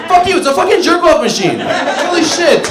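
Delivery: high, loud, laughing through it; laughing rant. Real, not acted. Comedian to crowd about the iPad, loud laughing rant. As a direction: crack yourself up as you yell. Ranting at the audience about iPads.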